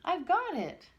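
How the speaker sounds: high, loud gleeful cry; delighted. Acted. Pixie delights in getting his wand back. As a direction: gloat over the wand happily. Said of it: "shouted Pixie in delight".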